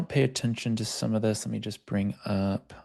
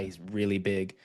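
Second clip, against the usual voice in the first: ordinary talk, nothing marked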